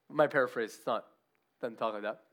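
normal talking voice

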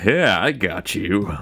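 slow, cool dude voice; laid-back dude. Retold or imitated. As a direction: nod along coolly. Zach toasts with beer.

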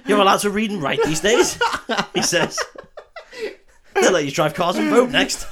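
high, loud, laughing disbelief; mock astonishment. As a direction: exclaim it as if scandalized, cracking up. Acts Marcus amazed at woman doctor.

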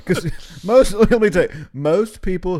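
high loud mock warning. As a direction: belt it out in mock drama.

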